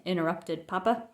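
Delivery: plain reading voice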